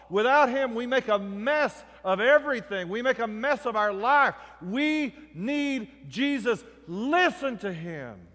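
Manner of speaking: high, loud pulpit climax, building in force; fervent preaching. Real, not acted. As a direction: drive each repeated plea home to the congregation.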